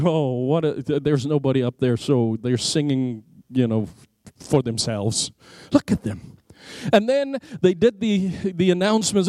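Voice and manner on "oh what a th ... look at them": lower, scornful muttering mockery; mocking scoff. Atheist scoffing at worshippers, retold with mocking low scorn. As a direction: sneer at the singing crowd, dropping low. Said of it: "Well, I mocked everything in my mind".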